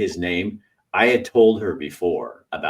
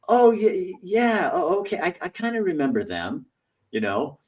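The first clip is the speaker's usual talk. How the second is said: high, sing-song feigned oh okay; sarcastic high mock agreement; mock fake remembering; 12 semitones higher than usual. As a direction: play along, knowingly insincere.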